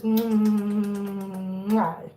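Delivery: long drawn-out "mmm-wah"; big extended smooch for a kitten; kissing noise. Real, not acted.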